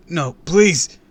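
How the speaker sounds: loud, high begging; panicked plea. A narrator's reading. Kid about to be thrown, panicked begging. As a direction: plead in sudden terror.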